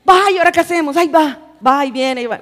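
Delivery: acting out a loud local cry of alarm; panicked outcry. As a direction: cry out in mock panic at trouble coming. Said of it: "como dicen aquí".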